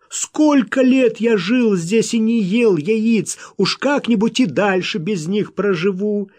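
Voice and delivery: loud, high-pitched pleading; imploring. A narrator's reading. Wild man haggling for a few dozen eggs, pleading. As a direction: beg desperately at full voice. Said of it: "взмолился бешеный".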